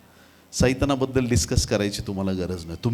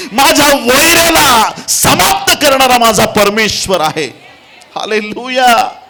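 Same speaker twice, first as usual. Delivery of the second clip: high, sustained roar of triumph; full-force preaching; 12 semitones higher, 12 dB louder, slower than usual. Real, not acted. Preacher proclaims his victorious God, shouting at full force. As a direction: thunder it out like a revival preacher.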